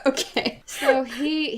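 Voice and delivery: usual speaking voice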